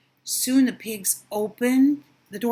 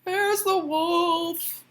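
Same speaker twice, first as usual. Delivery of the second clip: slow teasing pig voice; 7 semitones higher, much slower than usual. Acted. Pig jokes about wolf.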